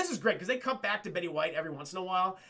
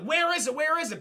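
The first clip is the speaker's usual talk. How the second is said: anxious repeat; 8 semitones higher, 5 dB louder than usual. Retold or imitated. Searching anxiously.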